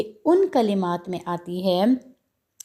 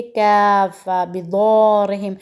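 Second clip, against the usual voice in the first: 1 recitation; 6 dB louder, much slower than usual. Shown as a demonstration. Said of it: "अगले हरूफ की ख़ुद practice करनी है".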